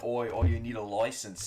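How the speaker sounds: a cliché Cockney bark; Cockney impression. Vlogger mocking the overdone Cockney bit, mock Cockney accent. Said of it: "the overwhelmingly Cockney British".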